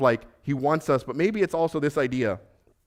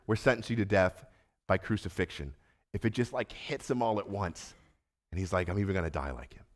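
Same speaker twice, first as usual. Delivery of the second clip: hushed dread; 6 semitones lower, 8 dB quieter than usual. Acted. Peter's death sentence, sinking.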